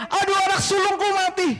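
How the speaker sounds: high, loud cry of grief; anguished wail. Acted. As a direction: wail like a mother who just lost her firstborn. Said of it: "teriak kayak gitu".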